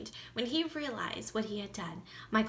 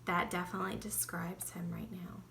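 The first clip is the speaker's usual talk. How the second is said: whispering; 4 semitones lower, 7 dB quieter, faster than usual. Real, not acted. Quiet aside to listeners.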